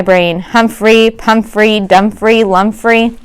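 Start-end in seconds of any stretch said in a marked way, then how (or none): none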